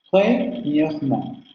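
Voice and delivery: "Premièrement" said slowly, every syllable drawn out with a clearly sounded schwa; slow French demo. Shown as a demonstration. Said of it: "tenemos que pronunciar sí o sí la vocal".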